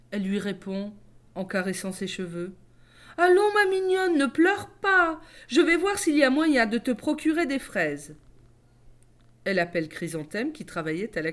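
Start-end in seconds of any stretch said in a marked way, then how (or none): 3.2-8.2 doting mother. Acted. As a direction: soothe the spoiled child with honeyed indulgence. Doting mother soothes crying daughter.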